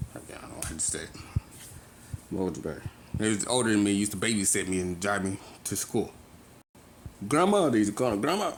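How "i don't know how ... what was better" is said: whispered aside. Real, not acted. Searching for words mid-story, whispered aside. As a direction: hush it like a private thought.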